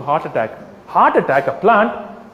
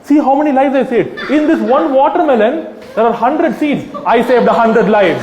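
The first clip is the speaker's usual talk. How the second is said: laughing mock boast; 6 semitones higher, 4 dB louder than usual. Real, not acted. Absurd boast while laughing.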